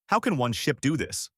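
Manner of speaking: usual speaking voice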